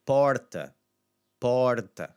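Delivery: emphatic model "porta", open O. Shown as a demonstration. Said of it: "Again, the aw sound".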